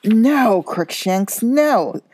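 loud alarmed cry of no. A narrator's reading. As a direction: shout to stop the cat. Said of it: "cried the witch".

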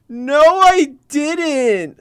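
high, very slow, drawn-out denial; sister's denial. Retold or imitated. Four-year-old sister denying the punch to mom, high and very slow. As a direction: drag out a childish no. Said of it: "she's like".

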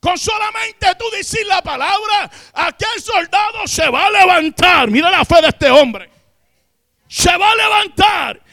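high, loud, slow preaching cry; a preacher crying out the centurion's faith; preaching shout. Acted. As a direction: lift it to a fervent shout. Sermon climbs to full preaching.

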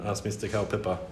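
ordinary delivery, nothing marked

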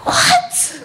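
high, shocked "What?"; stunned disbelief. Retold or imitated. Niece hearing the family secret, stunned high squeak. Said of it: "I'm like".

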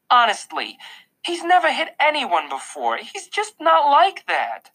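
high, distressed mother's voice; upset pleading. A narrator's reading. Mom to Mister Tushman defending son, upset.

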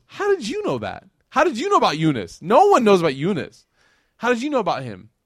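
high, loud amazed questions; a servant stunned that a stranger knows Yunus. Retold or imitated. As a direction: burst out in amazement. Adas amazed about Yunus.

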